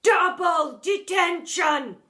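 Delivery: slow, high, loud bellow; deputy head bellowing a punishment. A narrator's reading. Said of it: "bellowed Miss Seeth".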